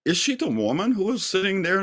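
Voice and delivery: quick, high-pitched wondering aloud; startled inner thought. Retold or imitated. Remembering a woman he once noticed, asking himself in quick high surprise. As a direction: rush the question up in pitch, half-disbelieving.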